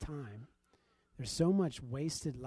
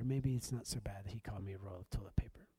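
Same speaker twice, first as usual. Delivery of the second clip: lower, soft whisper to himself; relieved whisper; 5 semitones lower, 4 dB quieter, faster than usual. Retold or imitated. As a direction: mutter the relief quietly.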